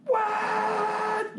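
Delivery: long high horn blast; mock train horn blaring; train horn imitation. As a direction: imitate a locomotive horn with a held high note. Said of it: "It just goes".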